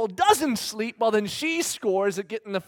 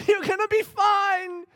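a hint of cheery reassurance; 10 semitones higher than usual. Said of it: "And I said".

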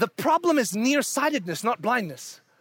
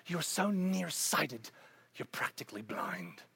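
hushed warning; 8 semitones lower, 13 dB quieter than usual. Real, not acted. Preacher warning listeners in a much softer whisper. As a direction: let it sink in, barely audible.

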